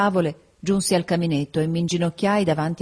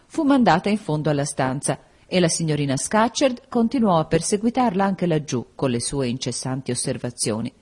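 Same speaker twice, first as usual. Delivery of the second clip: ordinary delivery, nothing marked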